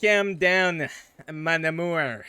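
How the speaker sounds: a French Canadian charmer soothing; French Canadian accent. Shown as a demonstration. Justin calming Chad, French Canadian accent. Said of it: "There's your French Canadian".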